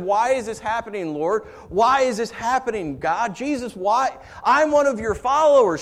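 high, loud pleading questions to God; a believer crying out why, loud and high; anguished cry to God. Acted. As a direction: cry out to heaven in hurt. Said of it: "she cried out to God".

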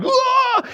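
very high comic yelp; mock shriek of fright; startled kid's scream. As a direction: imitate a terrified kid's squeal. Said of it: "the kid was like".

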